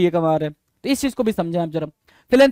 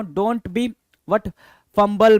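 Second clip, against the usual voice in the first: ordinary talk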